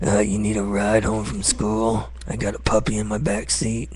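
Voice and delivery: lightly voiced creep luring a kid; dirty old man voice. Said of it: "that's my dirty old man voice".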